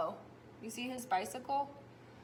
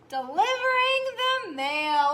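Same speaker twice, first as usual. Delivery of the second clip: loud, much higher big-text reading; teacher reading the big punchline words; loud reveal; 9 semitones higher, 10 dB louder than usual. Acted. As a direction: announce it like a surprise. Big text read aloud.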